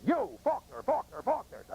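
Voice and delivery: high, ringing call across a distance. Retold or imitated. Veteran calls his buddy's name at the memorial, high call. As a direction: call out the name like hailing a friend.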